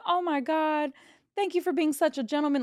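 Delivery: hyping him up. Retold or imitated. Dealer thanks the floor who picked up chips, gushing hype. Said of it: "I'm hyping my floor up".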